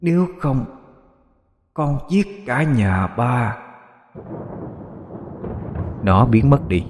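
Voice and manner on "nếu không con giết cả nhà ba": put-on slow, high, eerie ghost child. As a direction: stretch out the threat like a little demon. Demon child threatens his father.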